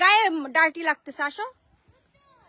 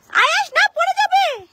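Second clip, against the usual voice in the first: sharp cry, much higher and louder; alarmed warning; 13 semitones higher, 7 dB louder than usual. Real, not acted. Mother warning her son he will fall, alarmed high cry. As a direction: panic as he slips.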